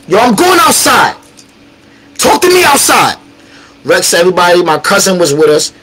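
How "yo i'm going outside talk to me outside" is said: high, heated call-out; retold challenge. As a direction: challenge him to step outside, acted out. Retelling his challenge to fight.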